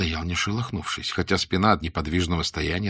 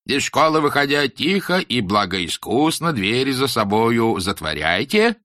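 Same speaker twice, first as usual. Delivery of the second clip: high, slow, solemn schoolmaster; stern teacher voice; 4 semitones higher, 4 dB louder than usual. Acted. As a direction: preach it like a strict old teacher. Teacher instructs leaving pupils.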